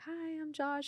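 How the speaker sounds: small, high, soft hello; retold starstruck greeting. Husband introduces himself to his idol, high and soft.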